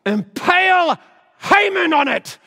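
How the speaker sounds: high, loud clenched-jaw order; through gritted teeth. Acted. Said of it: "said through gritted teeth".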